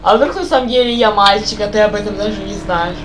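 ordinary delivery

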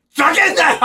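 loud mock angry yell; angry shout. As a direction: blow up at them like you're fed up. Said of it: "まっちゃんキレてたの？". (怒鳴り声のまね)

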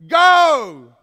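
exclamation shout. Shown as a demonstration. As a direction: bark "Go!" like a command. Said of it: "It's not the exclamation point".

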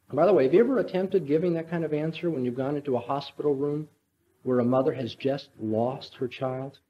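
ordinary talk, nothing marked

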